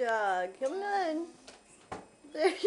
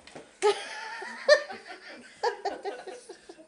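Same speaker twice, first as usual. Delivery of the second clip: long delighted laugh; 10 semitones higher than usual. Real, not acted.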